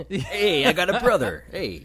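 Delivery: loud, high, goofy exclamation through laughter. Acted. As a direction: crow it like a delighted goof.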